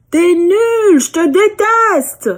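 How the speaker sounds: put-on high, loud, slow childish outburst; angry child. Girl angrily yelling at the echo. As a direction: yell it like a cross little girl. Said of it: "elle s'énerve un peu".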